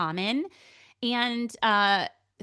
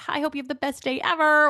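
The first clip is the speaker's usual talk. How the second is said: mock cheerful greeting; 6 semitones higher, 5 dB louder, faster than usual. Mock sweet birthday text.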